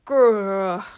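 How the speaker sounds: loud rumbling growl; werewolf growl. Acted. Werewolf ending his warning with a loud growl. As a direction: growl like an angry beast. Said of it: "followed by".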